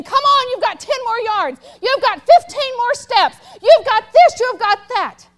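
high, loud shouted encouragement; coach urging on. Retold or imitated. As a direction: push him on like a coach on the field. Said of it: "the coach was saying".

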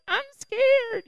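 put-on a sixth grade girl afraid to swim back; scared girl. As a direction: squeak it like a frightened sixth grader. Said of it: "this sixth grade girl's like".

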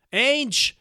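high, loud call down the corridor; shouting out to a friend in prison, high and loud. Acted. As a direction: yell a friend's name across the cells. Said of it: "brüllte ich".

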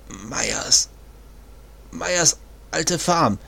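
faint, slow, failing voice; fading with the last breath; bleeding out. Acted. Dying man gasps out directions.

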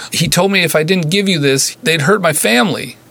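high, tearful cry of sorry; blurting it out through tears; weeping apology. A narrator's reading. Nurse weeping to her patient, high tearful cry. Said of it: "she bellowed".

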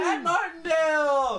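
loud, high, slow winner call; announcing the winner. Real, not acted. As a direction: crown the champion.

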